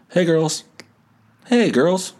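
put-on high, sing-song hey girls; mock creepy high greeting to girls. Shown as a demonstration. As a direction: lilt it way up like a creep. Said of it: "Coming from a thirty-six-year-old man".

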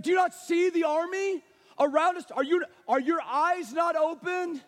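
high, loud incredulous questioning; acted alarm, demanding to be believed; frantic servant boy pleading to be heard; panicked disbelief. Acts panicking servant questioning Elisha.

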